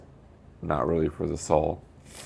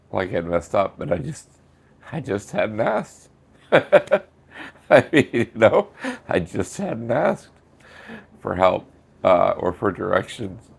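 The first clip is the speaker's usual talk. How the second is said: crying through the words, half laughing; 5 semitones higher, slower than usual. Real, not acted. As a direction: voice breaking high and slow. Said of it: "the reason I'm crying".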